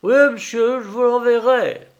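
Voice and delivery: high, loud peasant woman's reply; farmwife's eager assent. Acted. (fermière empressée, voix haute et forte)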